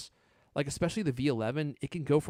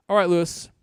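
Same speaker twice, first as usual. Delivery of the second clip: loud call-out; 5 semitones higher, 8 dB louder than usual. Real, not acted. Host calls co-host sign-off.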